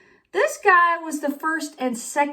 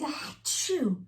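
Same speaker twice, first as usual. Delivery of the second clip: sneeze; 4 semitones higher, 8 dB quieter than usual. Acted. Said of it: "I sneezed a giant sneeze".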